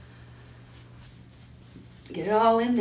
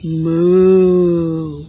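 long, loud moo; drawn-out, louder cow call; 3 semitones lower, 6 dB louder than usual. Shown as a demonstration. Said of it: "What does a cow say?".

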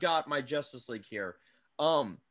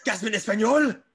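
put-on Spanish accent; 4 semitones higher, 5 dB louder than usual. Jokingly acts out shocked Spanish gasp.

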